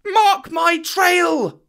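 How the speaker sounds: high, slow shouted call; shouting. A narrator's reading. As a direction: shout it up to the sky. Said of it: "Mowgli shouted".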